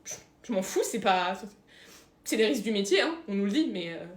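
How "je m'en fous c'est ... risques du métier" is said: ordinary talk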